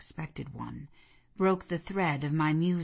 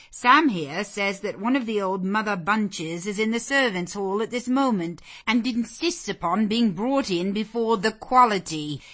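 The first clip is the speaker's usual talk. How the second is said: raised, louder address to the room; speaking aloud; 5 semitones higher, 6 dB louder than usual. Acted. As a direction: announce it to all the ladies. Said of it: "And speaking aloud".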